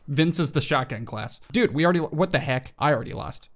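normal talking voice

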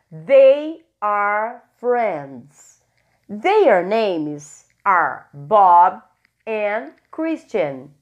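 very slow, word by word; slow model sentences. Shown as a demonstration. Teacher dictates sentences slowly to the class. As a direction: drag each word out for learners. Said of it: "teacher models sentences slowly".

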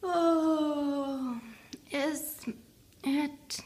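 high, drowsy yawning question; sleepy yawning. A narrator's reading. Teddy asks sleepily before bed, high and yawning. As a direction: yawn the words out. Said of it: "Teddy yawned".

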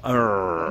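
low playful mock lion roar; a tiny lion's growl, pitched low; baby roar. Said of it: "That was my little roar, my baby roar".

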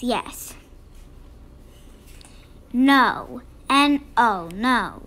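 ordinary delivery, nothing marked

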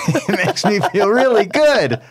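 high, drawn-out giggly delight; slow, squealy laughing answer; laughing gleefully. Real, not acted. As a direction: laugh through the words, pitch way up. Gleeful answer to how it feels.

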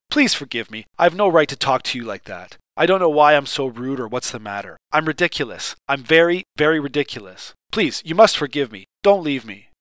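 usual speaking voice

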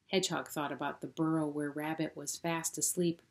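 plain reading voice

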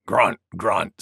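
low boar grunting; deep, piggy character voice; wild boar. Acted.

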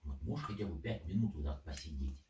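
normal talking voice

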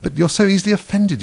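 high-pitched protest; plaintive complaint; pleading. A narrator's reading. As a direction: appeal earnestly, pitch well up. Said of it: "pleaded poor Alice".

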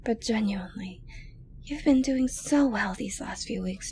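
ordinary talk

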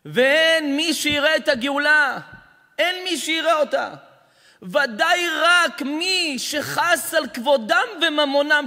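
loud, slow sermon cry; booming drawn-out preaching; fiery preaching. Acted. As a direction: preach it out to the hall. Rabbi preaching at full force.

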